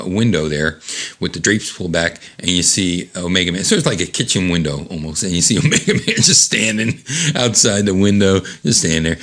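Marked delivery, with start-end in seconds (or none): none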